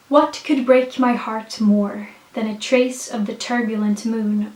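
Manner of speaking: ordinary talk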